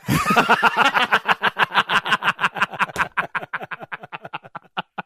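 laughing hard at the punchline; long laugh. Real, not acted. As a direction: crack up at your own joke. Laughing at own joke.

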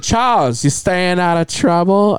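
imitated Chinese accent, name pitched high; Chinese doctor. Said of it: "my Chinese".

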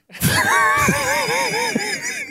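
high, shouted cackle of laughter. Real, not acted. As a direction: burst out laughing hard. Laughs at own Monday answer.

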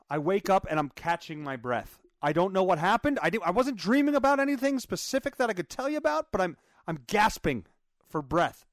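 ordinary talk

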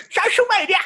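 hammed-up squeaky jingle shout; silly shriek. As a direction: shriek it playfully, breaking into laughter. Clowning the socials segment intro.

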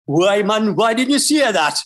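mock Geordie with a laugh, "why man"; Geordie accent. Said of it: "So you gotta speak like them".

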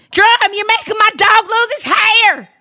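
put-on high Southern belle accent, Weezer. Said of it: "I do a very good Southern".

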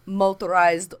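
slow, loud bad Russian accent; half-assed Russian, stiffly commanding; mock Russian accent. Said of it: "your Russian accent".

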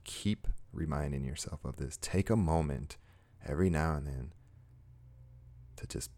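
soft, unhurried guided meditation voice; guided heart moment. Acted. Host guiding viewers to love themselves, soft meditative voice. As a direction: gently talk the listener into a calm moment.

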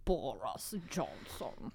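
slow, low, quiet drawl of the name; drawn-out name. Acted. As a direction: draw the name out slowly and softly. Names the Trump-like leader, drawn out.

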